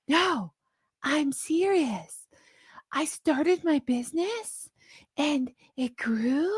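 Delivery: mock baby talk, high and slow; teenage upspeak, soft and stretched out; Jamie Kern Lima. Host imitates guest insisting she's serious, in mocking baby talk. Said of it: "The baby talk".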